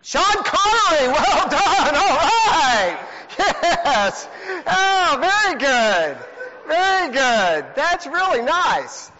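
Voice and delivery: high, loud delighted praise with a laugh; excited cheering. Real, not acted. Youth leader cheering a team's correct Bible answer, excited. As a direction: celebrate the right answer out loud.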